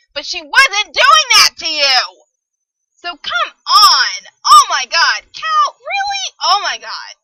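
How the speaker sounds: slow, loud, strained outburst; loud frustrated wail at the book; exasperated yelling. Real, not acted. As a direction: shout it out in disbelief. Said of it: "I'm yelling so much".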